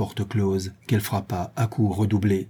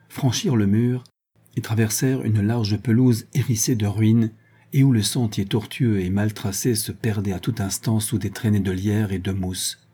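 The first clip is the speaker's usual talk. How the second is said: usual speaking voice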